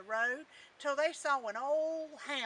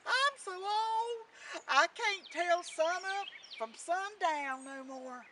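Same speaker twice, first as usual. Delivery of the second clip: slow, raised rooster voice; old rooster; 5 semitones higher, 3 dB louder, slower than usual. A narrator's reading. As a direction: draw it out like a weary crowing bird. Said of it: "It was an old rooster".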